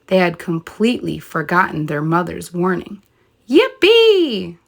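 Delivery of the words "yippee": high, loud yippee; gleeful cheer. Acted. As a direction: shout it up high with joy. Pigs dancing and playing.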